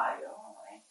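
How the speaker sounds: unvoiced whisper. Reacting to the interrogator, whispered. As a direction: breathe it out as a whisper.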